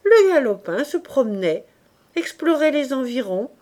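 ordinary delivery

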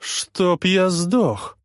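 high, drawn-out shocked cry; stunned exclamation. A narrator's reading. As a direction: blurt it out in disbelief. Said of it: "восклицает отпрыск".

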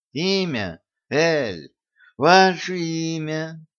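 slow, slurring tipsy explanation; slurred drunk speech. A narrator's reading. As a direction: drag the words like a drunk. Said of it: "заплетающимся языком пояснил я". (заплетающимся языком)

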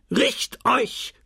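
high barked order; barked drill commands. A narrator's reading. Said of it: "brüllte Binet". (hoch gebellter Befehl)

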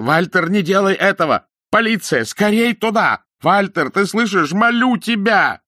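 very high, loud begging cry; desperate screaming plea. A narrator's reading. Terrified man screaming to his accomplice over the radio. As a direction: scream and beg for your life. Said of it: "вырвал микрофон ... и закричал".

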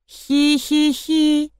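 high, slow monotone "hee-hee-hee"; eerie toy giggle. A narrator's reading. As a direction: giggle like a spooky toy, drawn out. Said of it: "Тихо, но отчётливо прошелестело". (жуткое протяжное хихиканье)

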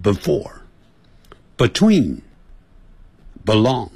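before, between, belong run together with a light be-; quick reduced be-. Shown as a demonstration. Teacher imitating how foreigners say be- words fast. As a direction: clip the be- like a native speaker talking fast. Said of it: "念快的时候变成".

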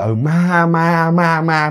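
dazed, drawn-out repetition of one word; mildly acted fright, stuck on a word; struck nearly speechless. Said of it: "ông chỉ nói được mấy cái chữ là".

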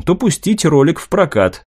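plain reading voice

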